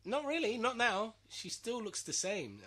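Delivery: high loud outburst; loud exclaim. Real, not acted.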